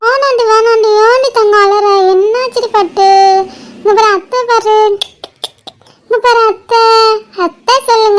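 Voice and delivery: put-on gentle sing-song hushing of a crying baby; cooing auntie, lightly lifted pitch; soothing baby talk. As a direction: coax the baby out of tears.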